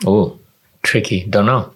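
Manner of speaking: normal talking voice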